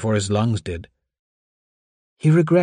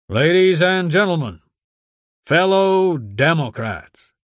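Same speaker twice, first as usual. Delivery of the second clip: pompous senator oratory; 7 semitones higher, 4 dB louder than usual. Acted. Senator addresses the crowd.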